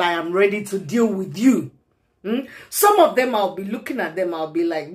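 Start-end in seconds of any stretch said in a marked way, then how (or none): none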